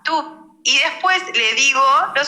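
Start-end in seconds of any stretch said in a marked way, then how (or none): 0.0-0.6 a quick, high, soft toot; short train horn. Shown as a demonstration. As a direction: cut the horn call short like a small train. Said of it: "toca una bocina corta". (bocina corta de tren, aguda y breve)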